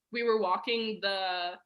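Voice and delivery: ordinary talk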